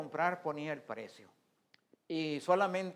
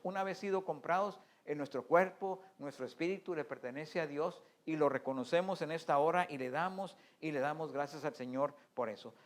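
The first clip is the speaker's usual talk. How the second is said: ordinary delivery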